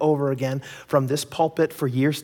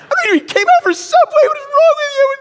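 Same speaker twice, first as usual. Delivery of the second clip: much higher, louder whiny wife voice with a mock sob; outraged wife; 9 dB louder than usual. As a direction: wail it like the fed-up wife. Wife upset about Subway.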